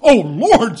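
loud, high "Oh, Lord" of amazement; shocked aunt. Acted. Aunt stunned to learn the boy is her nephew, loud shocked cry. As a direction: gasp it out, thunderstruck. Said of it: "said my aunt".

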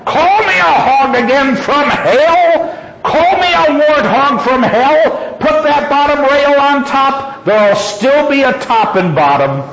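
indignant high-pitched yell; furious yelling. A narrator's reading. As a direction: holler it across a field in a rage. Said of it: "she yelled".

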